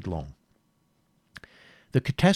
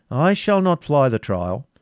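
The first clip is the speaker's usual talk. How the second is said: slow, firm and raised voice; composed yeoman; 5 semitones higher, 5 dB louder than usual. A narrator's reading. Yeoman accepting the prince's trial, composed and firm. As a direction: stand your ground steadily. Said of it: "with the composure which marked".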